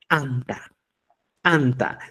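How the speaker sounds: ANTA begun with a popped ejective t; explosive t. Shown as a demonstration. Teacher saying Cusco's word for bread, explosive ANTA. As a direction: burst the tongue off the teeth into ANTA. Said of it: "explosión".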